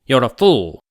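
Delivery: a cutting, loud reproach; sharp rebuke. Acted. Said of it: "said Doctor Manelli sharply".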